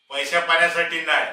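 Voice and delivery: loud, forceful denial; indignant. Acted. Servant insists to his master it was never money, indignant. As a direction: push back hard against the very idea.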